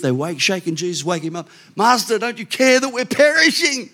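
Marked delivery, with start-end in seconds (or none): 1.8-3.9 a frantic plea from a sinking boat; panicked disciples. Acted. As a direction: plead like men about to drown.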